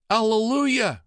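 high, loud ecstatic shout; joyful cry. Acted. Monk filled with the Spirit, crying out in joy. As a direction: cry out in rapture. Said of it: "cried Brother Malcolm".

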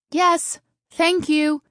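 high, loud call; high-pitched shouted reply; matter-of-fact shout. A narrator's reading. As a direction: shout it back briskly, very high. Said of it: "Taryn shouted matter-of-factly".

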